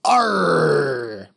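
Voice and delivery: drawn-out pirate "Arr"; pirate captain. Acted. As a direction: growl a long pirate arr, falling in pitch. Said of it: "pirate captains ... and they say".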